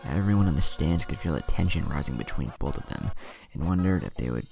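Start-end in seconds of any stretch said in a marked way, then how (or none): none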